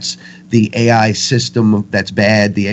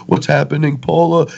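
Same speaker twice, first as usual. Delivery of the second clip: rising into higher-pitched mock confusion; retold bewildered call; 6 semitones higher than usual. Man with no clue calls to Paula, higher mild bewilderment. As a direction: call out for help, mildly lost.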